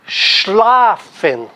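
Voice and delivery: Schlafen with a strong, long Schla; stress on first syllable. Shown as a demonstration. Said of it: "tá no schla".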